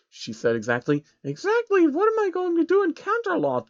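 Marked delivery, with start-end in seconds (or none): 1.2-3.7 much higher timid pony voice; small high stammered yes; Trixie's high voice. A narrator's reading. Trixie asks.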